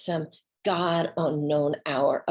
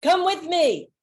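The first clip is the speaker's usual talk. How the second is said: eager invitation; 11 semitones higher, 5 dB louder than usual. Retold or imitated. Keating inviting her along.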